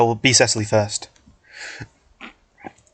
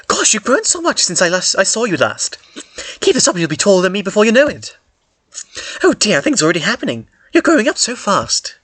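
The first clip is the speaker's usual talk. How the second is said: doting motherly voice; 6 semitones higher than usual. Acted. Hemifi hugging the boy who has grown, high doting gush. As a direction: gush over the boy with pride.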